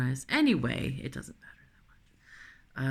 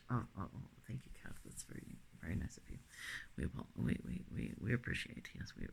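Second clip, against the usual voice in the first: soft cooing to cat; 4 semitones lower, 14 dB quieter, faster than usual. Real, not acted.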